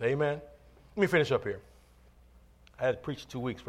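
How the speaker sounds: ordinary talk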